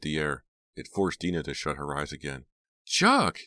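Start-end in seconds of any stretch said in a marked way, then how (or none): 2.8-3.4 very high, loud shriek. A narrator's reading. Jade shrieking at the fallen Chuck, loud shriek. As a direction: shriek his name. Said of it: "Jade was shrieking".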